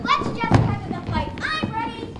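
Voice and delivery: slow, loud challenge; loud eager shout. Acted. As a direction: call out ready to fight. Native eager to fight.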